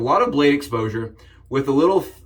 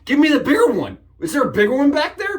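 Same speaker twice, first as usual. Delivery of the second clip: mock outrage; 11 semitones higher than usual. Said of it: "I'm like".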